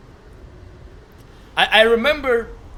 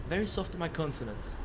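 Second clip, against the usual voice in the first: hushed, much softer echo; 14 dB quieter than usual. Real, not acted. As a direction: repeat it quietly to yourself. Echoes chat's remark on soft consonants.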